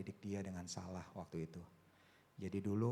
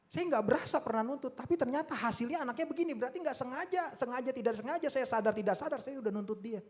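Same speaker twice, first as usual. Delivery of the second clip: high, raised-voice confession; impassioned preaching; 14 semitones higher, 8 dB louder than usual. Real, not acted. Father confessing unintended demands to congregation, impassioned preaching. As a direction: press the realization on the congregation.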